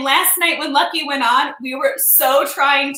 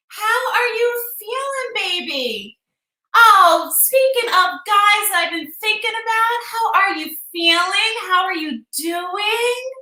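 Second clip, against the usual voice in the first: tender greeting; 7 semitones higher than usual. Real, not acted. Fond greeting, drawn out.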